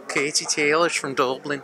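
slow, weak Dublin accent attempt; a shaky try at a Dublin accent. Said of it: "Can you do a Dublin one".

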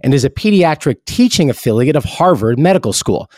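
even, polished ad read; host switching into a hospital sponsor announcement. Acted.

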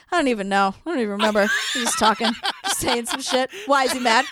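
low, mumbly mock cluelessness; clueless producer. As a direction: imitate a producer playing dumb. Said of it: "And the producer goes".

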